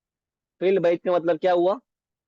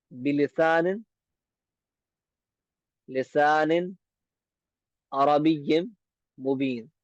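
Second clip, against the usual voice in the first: word by word. Shown as a demonstration. Teacher breaks the Arabic line into words for students. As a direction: read बिलिसानिन, लिसानिन, अरबिय्यिम, Mubeen with long pauses between. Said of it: "ये लिखा हुआ है यहाँ पर".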